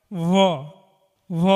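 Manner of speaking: ভ pronounced as a v sound; teeth on lip. Shown as a demonstration. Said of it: "ওপরের দাঁত নিচের ঠোঁটে লাগিয়ে উচ্চারণ করিতে হয়".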